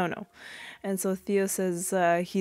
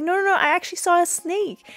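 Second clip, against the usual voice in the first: put-on high, pleading kid's voice; insistent little girl; 11 semitones higher, 3 dB louder, faster than usual. Daughter pleading with her father, high kid voice. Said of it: "And she's like".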